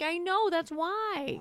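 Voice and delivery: high, drawn-out imitation of a scheming woman; acted reply. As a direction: stretch it out high, mock conspiratorial. Acting out Mara's reply.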